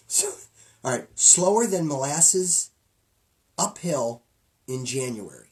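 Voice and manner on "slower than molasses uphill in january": slow saying. Shown as a demonstration. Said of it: "I don't know if I did that one right".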